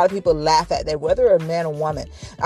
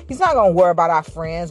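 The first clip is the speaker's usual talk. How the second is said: usual speaking voice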